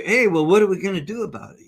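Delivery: retold, like a bubbly cheerleader pumping her up; all cheery. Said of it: "And I'm all cheer".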